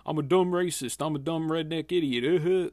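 mock Southern accent parodying how critics talk about Republicans. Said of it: "that classic, uh, pejorative Southern accent".

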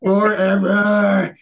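slow, loud hammed-up roaring; mock bear roar. Recruit roaring while bear crawling.

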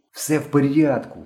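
usual speaking voice

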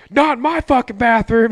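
hammed-up outrage at the house owner; mock angry buddy.

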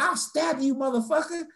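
put-on high-pitched drunk girl threatening; hammed-up high menace; mimicked threat. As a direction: play her threat high and heated. Imitating girl's paintbrush threat.

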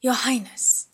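sharp alarmed shout; yelling. A narrator's reading. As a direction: cry out in shock. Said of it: "he yelled".

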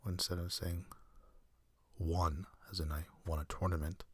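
ordinary delivery

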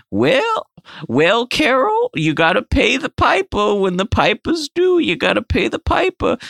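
high-pitched scolding mom. Retold or imitated. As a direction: chide like a prim old mother. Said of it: "his mom was like".

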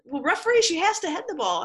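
high, insistent mock complaint; retold protest. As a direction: imitate someone pleading a case to the referee. Acts out the complaint to referee.

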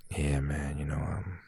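soft mumbling coworker impression; low talker impression. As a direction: imitate a coworker who barely speaks up. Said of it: "That's how he talks".

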